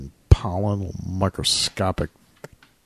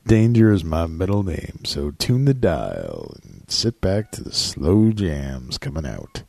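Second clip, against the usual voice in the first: sultry radio host; 4 semitones lower than usual. Pretend. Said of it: "Family, family friendly show".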